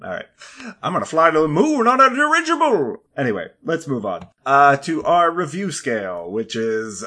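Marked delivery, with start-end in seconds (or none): 0.8-3.0 put-on high, loud old-timey announcer voice; old newsreel narrator. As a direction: sell it like a Zeppelin-era radio man. Continues old-timey voice bit.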